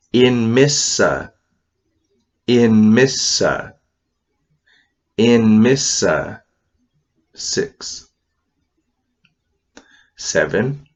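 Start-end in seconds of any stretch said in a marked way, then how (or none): 0.1-6.4 In Missa slow, syllable by syllable; slow teacher demo of In Missa; Kootenai word. Shown as a demonstration. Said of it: "Number six".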